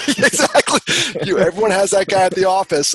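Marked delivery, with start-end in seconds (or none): 0.0-0.8 loud, high agreement through laughter; real laughing. Real, not acted. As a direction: laugh out the word in delighted agreement. Laughing at listener's reply-all joke.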